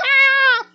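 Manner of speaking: high mock scream of a scared boy; imitated child's scream. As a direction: shriek like a kid in a panic. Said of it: "and he's like".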